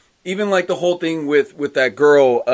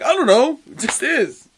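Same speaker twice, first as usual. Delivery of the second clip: high-pitched, hammed-up exasperation; flustered retort; 11 semitones higher, 5 dB louder than usual. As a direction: act stumped and defensive. Gambit's baffled retort, acted.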